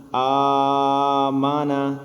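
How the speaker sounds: آ مانا with the first آ stretched long and sung. Shown as a demonstration. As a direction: draw out the opening آ slowly like Quran recitation. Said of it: "لمبا کیا". (آ کو لمبا کھینچ کر)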